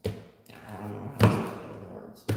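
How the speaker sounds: breathy repeat under the breath; hushed, voiceless aside; whisper. Shown as a demonstration. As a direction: say it again as a whisper to yourself. Repeats line, then whispers it.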